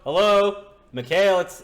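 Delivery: high, booming mock hello; mocking loud greeting. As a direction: bellow a parody of the player's greeting. Game master mimics player's blundering greeting.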